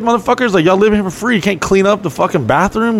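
high, scolding rant at the roommates; mock angry rant. Yelling at freeloading roommates.

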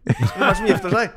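loud, high, laughing curiosity; excited question. Acted. Friend asking who is getting married, loud excitement. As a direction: blurt it out high and eager. Friend eagerly asks about the wedding.

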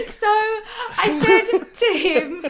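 slow, high, words through laughter; can barely get it out for laughing; laughing while retelling. Real, not acted. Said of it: "laughing while telling".

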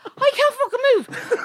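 high, laughing re-enacted protest; exasperated protest. Oiled-up client protesting to masseuse, high exasperated cry. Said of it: "I was like".

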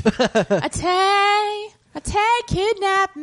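high-pitched hammed-up character voice; mock film character. Mocking a film role, high hammed-up voice. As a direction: wail it high as a parody.

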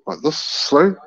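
ordinary talk, nothing marked

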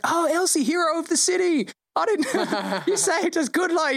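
the GM voicing the Lord Mayor, high and fussy. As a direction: pitch it way up like a flustered dignitary. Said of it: "It's like".

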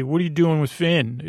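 ordinary talk, nothing marked

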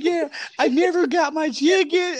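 high-pitched imitation of a scammer; mock customer complaint. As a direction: play the fake complaint high and put-on.

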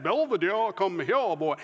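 put-on genteel Southern accent, swooping pitch; Southern accent with exaggerated rises and falls; sophisticated Southern accent. Shown as a demonstration. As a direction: attempt an old-style sophisticated Southern accent. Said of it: "that's a sophisticated Southern accent".

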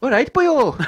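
high, lilting mock Welsh; Welsh accent. Shown as a demonstration. Doing accents on friend's request, high playful Welsh. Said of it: "Come on, do Welsh".

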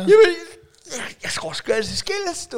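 high, hammed-up hairdresser impression; chatty hairdresser. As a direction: start on a loud squeal, then chatter high. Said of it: "det er jo det, frisører kan".

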